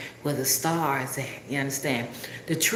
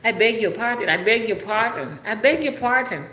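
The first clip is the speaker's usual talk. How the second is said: higher-pitched, repeated puzzled query; puzzled overseas caller; 6 semitones higher than usual. Retold or imitated. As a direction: mimic a caller who cannot understand. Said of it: "people who call me from overseas".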